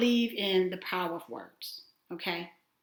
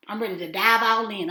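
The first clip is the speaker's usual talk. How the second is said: ordinary talk, nothing marked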